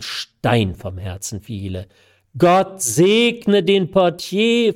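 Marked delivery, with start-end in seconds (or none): 2.4-4.7 slow, high, louder burst of delight. Acted. Young man overjoyed at his bride's news, delighted. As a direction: beam as you bless him. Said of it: "versetzte ich ganz entzückt".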